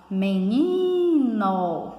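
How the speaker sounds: slow, sung-out "meniiino"; calling-out stress. Shown as a demonstration. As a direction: call out "menino", holding the "ni". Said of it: "quando a gente pronuncia".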